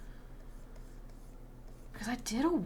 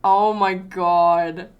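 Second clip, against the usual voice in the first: loud, very drawn-out astonishment; amazed; 13 dB louder, much slower than usual. Real, not acted. Amazed reaction on stream.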